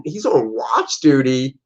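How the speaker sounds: ordinary talk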